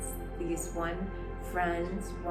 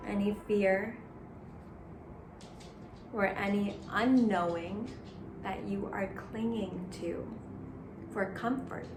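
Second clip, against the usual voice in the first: guided meditation. Acted. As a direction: gently guide the breath. Leads a Sat Nam meditation.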